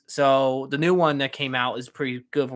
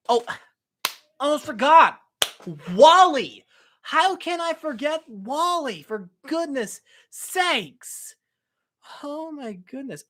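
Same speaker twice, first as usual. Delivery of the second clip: excited remembering; 9 semitones higher, 4 dB louder, slower than usual. Real, not acted. Suddenly remembers Wall-E.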